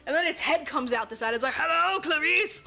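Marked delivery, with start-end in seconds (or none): 1.5-2.6 slow, loud, drawn-out greeting; hammed-up movie quote voice; mock film villain. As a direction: stretch the greeting like a creepy villain. Voices unicorn head as film villain.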